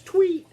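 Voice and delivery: very high, loud chirp; piping imitation birdcall; bird tweet. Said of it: "Bird says".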